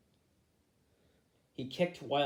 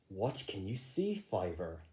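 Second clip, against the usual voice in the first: quiet question; 5 semitones lower, 5 dB quieter than usual. Acted. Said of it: "he asked".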